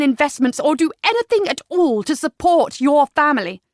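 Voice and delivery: loud, high, heated tirade; impassioned, building reproach; indignant. A narrator's reading. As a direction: throw bitter accusations at the father. Daughter berates father in quarrel.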